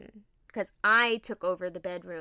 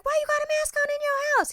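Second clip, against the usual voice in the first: much higher quoted dad voice; high put-on voice; 5 dB louder than usual. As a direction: squeak it out as dad.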